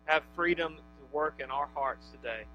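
soft prayer voice; closing prayer. Real, not acted. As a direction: pray quietly to the Father. Leads congregation in prayer.

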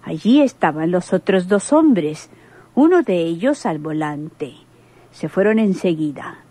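ordinary talk, nothing marked

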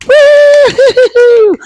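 high, loud, long "woo"; cheering whoop. Acted. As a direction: whoop for joy at full voice. Cheering at the story's end.

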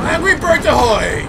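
loud, high sailor-like hail; playful battle cry. Pretend. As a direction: holler it as you fire. Launching another shot.

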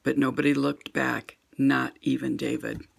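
usual speaking voice

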